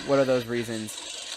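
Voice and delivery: normal talking voice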